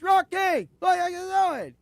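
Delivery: high, loud imitated movie line; acted call from far below; mock yell. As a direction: shout it up to a window, hammed-up. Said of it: "he's yelling up to the window".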